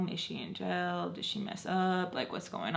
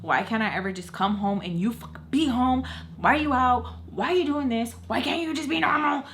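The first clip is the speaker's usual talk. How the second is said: furious acted rant, building to high shouting; freaking out; 4 semitones higher, 5 dB louder than usual. Going off on mom, angry freak-out. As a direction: start irritated, climb to a loud yell. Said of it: "freaking out 'cause I'm like".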